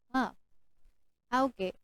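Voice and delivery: soft, unimpressed ah okay; bored reaction. Shown as a demonstration. Teacher shows the wrong, uninterested reaction. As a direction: shrug it off with no interest. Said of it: "hindi ganon".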